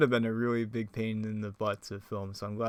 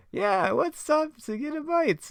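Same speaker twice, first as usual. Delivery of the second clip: very high, slow character squeak; put-on cartoon voice; slower than usual. Gang member suggesting a food stop, high put-on voice. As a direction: stretch it out in falsetto. Unnamed cartoon figure in impressions.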